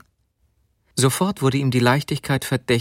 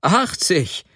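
higher-pitched, amused cry; laughing exclamation; 8 semitones higher than usual. A narrator's reading. Sobotka learning what his wife paid, laughing exclamation. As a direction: call it out with some amusement. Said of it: "rief Sobotka lachend".

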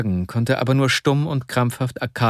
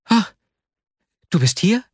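higher, startled exclamation; startled cry; 11 semitones higher, 4 dB louder than usual. A narrator's reading. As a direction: caught off guard, blurt it out. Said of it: "rief er aus und fuhr vor Schreck zusammen".

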